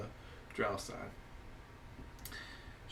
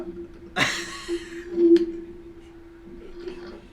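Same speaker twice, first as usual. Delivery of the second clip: wheezy silent laugh; 5 dB louder than usual. Real, not acted. Long laugh after "the pickle.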